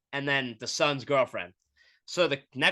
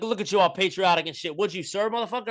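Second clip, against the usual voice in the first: higher, teasing roast of the patriotic shirt; mock comedian taunt; 6 semitones higher than usual. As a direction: rib him like a comic working the crowd.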